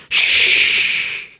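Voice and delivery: long forceful hush; shushing. A narrator's reading. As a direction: shush right into the ear. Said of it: "Alan said in his ear".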